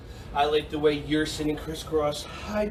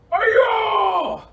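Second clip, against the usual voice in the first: full-throated high kiai yell; karate shout; 7 dB louder than usual. Shown as a demonstration. Teacher demonstrating a karate block to kids, loud high kiai.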